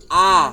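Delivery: a loud, clear open A; model vowel. Shown as a demonstration. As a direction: stretch the A wide for the child to repeat. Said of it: "Repeat after me ba?".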